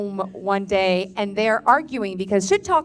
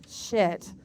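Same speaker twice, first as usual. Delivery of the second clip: usual speaking voice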